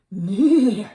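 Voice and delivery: very loud whinny; horse neighing. A narrator's reading. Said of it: "said Horse".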